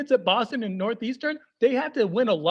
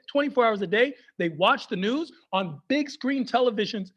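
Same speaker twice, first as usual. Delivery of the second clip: usual speaking voice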